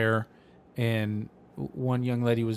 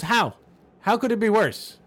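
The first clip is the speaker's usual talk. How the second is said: exasperated; 8 semitones higher, 3 dB louder than usual. Retold or imitated. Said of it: "And finally the guy goes".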